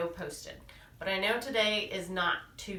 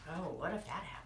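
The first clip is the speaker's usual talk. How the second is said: usual speaking voice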